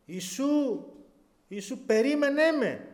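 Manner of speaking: high pleading call. Retold or imitated. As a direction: cry out to Jesus to wait. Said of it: "άρχισα να φωνάζω".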